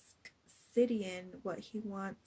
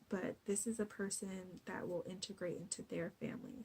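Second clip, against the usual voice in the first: usual speaking voice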